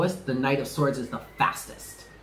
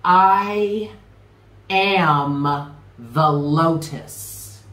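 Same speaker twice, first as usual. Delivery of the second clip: louder opening, extremely drawn-out mantra; led affirmation; 4 dB louder, much slower than usual. Shown as a demonstration. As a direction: proclaim it slowly, holding each word. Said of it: "One more time".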